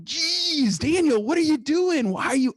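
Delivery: high-pitched mock scolding of a son-in-law; exasperated father-in-law. Father-in-law scolding Daniel for taking a call at dinner, high mock exasperation.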